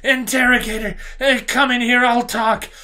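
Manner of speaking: high, loud, desperate pleading; panicked shout. Acted. Cornered alien begging the interrogator to intervene, shouted. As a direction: call through the door for rescue. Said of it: "he shouted".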